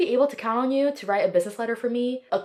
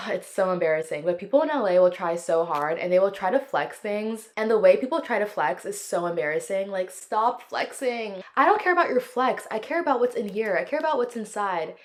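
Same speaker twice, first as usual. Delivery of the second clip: normal talking voice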